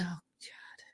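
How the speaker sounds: breathless "oh God" whisper; embarrassed whisper. Real, not acted. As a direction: whisper it, caught off guard. Asked to do a whining voice.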